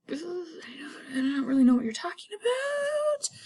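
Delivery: high, whispery, squeaky denial; nervous Lennox. Retold or imitated. Lennox dodging Macbeth's question in a high, whispery squeak. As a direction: shrink away and play dumb.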